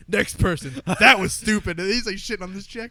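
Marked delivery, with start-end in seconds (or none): 0.1-1.8 loud, dismissive snap; dismissive Trump. Retold or imitated. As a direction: brush the reporter off loudly.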